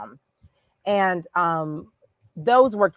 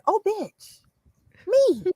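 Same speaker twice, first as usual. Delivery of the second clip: much higher, squealing with glee and laughter; gleeful squeal; 13 semitones higher, 5 dB louder than usual. Retold or imitated. Answering the Instagram post, a high laughing squeal. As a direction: shriek it out like you've found your people.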